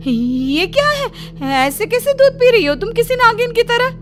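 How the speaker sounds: high, loud panicked outburst; frightened shriek. A narrator's reading. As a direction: shriek in alarm at the sight. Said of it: "जिठानी डर जाती है".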